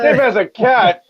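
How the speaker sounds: Dave has a cat in mock amazement, pitched high; mock shocked. Teasing the streamer, mock amazed that Dave has a cat. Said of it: "Oh my God".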